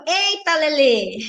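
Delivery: scandalized and amused, vowels held long; amused shock. Real, not acted. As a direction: stretch it out in comic embarrassment. Reads viewer's embarrassing story, exclaims.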